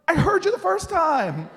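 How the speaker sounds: high, loud outburst; exasperated shout. Retold or imitated. Fed up with Miss Jones repeating herself, exasperated. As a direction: burst out with mock impatience. Acted impatience with elder.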